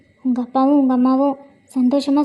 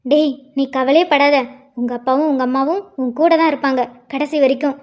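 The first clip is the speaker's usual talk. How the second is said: ordinary talk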